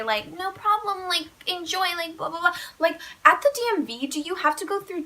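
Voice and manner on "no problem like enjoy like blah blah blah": high, sing-song courtesy; mock politeness. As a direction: chirp the niceties as a mocking example. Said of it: "you're not gonna say".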